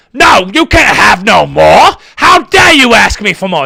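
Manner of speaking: loud, high, outraged mock British scolding; British orphanage master. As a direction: bellow a hammed-up refusal at a begging orphan. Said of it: "And it was a British".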